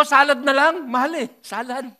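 retelling his flustered order at a pricey buffet; mock panicked order. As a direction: blurt the cheap order in a comic rush.

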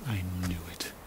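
unvoiced, low triumphant whisper. Victor whispering to himself as the sheep gives in. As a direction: hiss it to yourself under your breath. Private gleeful aside.

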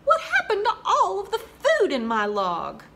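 hungry frog finding his log empty, laughing dismay; exclaimed. Acted. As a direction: cry out in comic shock. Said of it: "exclaimed the poor frog".

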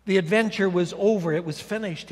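ordinary delivery

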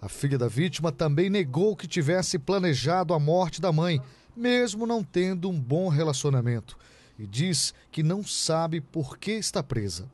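crisp, emphatic TV reporter read; measured, punchy reporting delivery; news report narration. Acted. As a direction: read it as a crime news voice-over.

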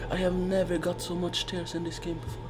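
very soft tearful voice; tearful, worn out. Real, not acted. Player telling opponent about the tears, soft and drained. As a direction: murmur it through real tears. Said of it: "I have so much tears".

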